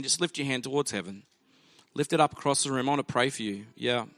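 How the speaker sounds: altar call prayer. Acted.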